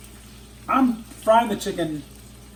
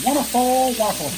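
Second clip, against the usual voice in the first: bandleader's lilting Wonderful, much higher on the first wonderful; 7 semitones higher than usual. Retold or imitated. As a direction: mimic a TV bandleader's catchphrase, lightly. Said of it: "There's my [name] quote".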